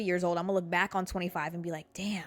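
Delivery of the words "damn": usual speaking voice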